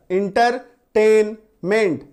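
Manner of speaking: Entertainment slowly split into inter, टेन, meant; syllable by syllable. Shown as a demonstration. Teacher counting syllables for students, entertainment said slowly in separate parts. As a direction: break entertainment into beats with clear pauses. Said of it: "तीन syllable".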